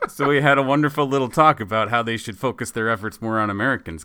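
ordinary delivery

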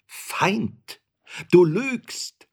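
high, loud outburst of fury; furious accusation, shouted high; frenzied scream. A narrator's reading. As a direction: cry out as if nearly out of your mind. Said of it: "schrie ich auf, beinah von Sinnen".

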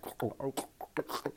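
wordless throaty choking sounds; imitated gagging noise. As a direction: mimic muffled gulping noises. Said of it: "I was still like".

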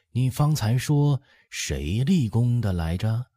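lightly voiced dowager, curious; inquiring old empress dowager. A narrator's reading. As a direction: ask it as an elderly matriarch.

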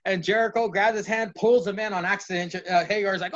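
normal talking voice

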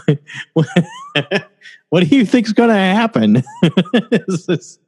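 imitating a friend who can't stop laughing; laughing imitation of Bert. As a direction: squeeze the words out through giggles.

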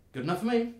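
high, loud gnome voice; gnome's ready agreement. Acted. Gnome answers her companion.

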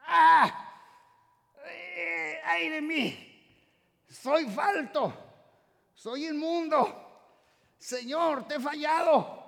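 high, loud, slow mock lament; repentant cry. Sinner before God's holiness, hammed-up anguished lament. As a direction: cry out in shame before God, drawn out.

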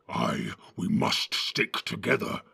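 growling orc chief; rough, forceful orc growl; Ugluk. A narrator's reading. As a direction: growl agreement with rough authority. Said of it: "growled Ugluk".

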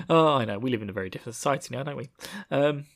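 ordinary talk

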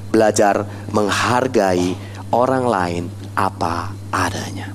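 low, slow, fading to a whisper; soft, weighty teaching point; hushed emphasis. Real, not acted. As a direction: space out the words and let them drop away. Preacher slows and hushes key lesson.

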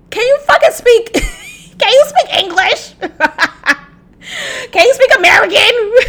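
very high-pitched, cracking up with laughter; playfully outraged, high and laughing; mock exasperated plea. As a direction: squeal the demand through giggles. Mock plea to British actors, laughing.

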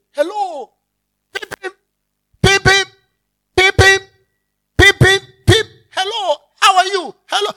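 high, bouncy mock greeting with beeping peeps; cheery greeter. Preacher imitating neighbours greeting him with high cheery peeps. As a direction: play an over-friendly neighbour waving from the gate.

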